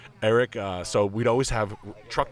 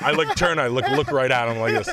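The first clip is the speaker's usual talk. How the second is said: usual speaking voice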